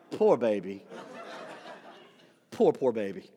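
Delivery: put-on low, soft poor-baby voice; mock pity. Mechanic pitying the driver, low soft mock pity. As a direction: coo down at him with pity. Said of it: "he gave me the poor baby face".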